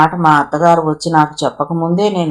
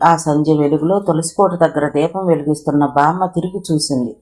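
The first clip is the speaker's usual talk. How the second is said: usual speaking voice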